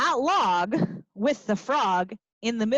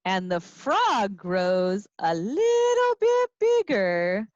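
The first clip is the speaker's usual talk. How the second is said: ordinary delivery, nothing marked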